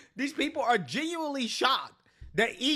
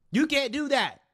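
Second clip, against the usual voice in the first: higher and louder, driving it home; louder, higher insistence; 5 semitones higher, 3 dB louder than usual. Acted. Commentator lectures entitled activists, raised insistent voice. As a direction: punch it home the second time.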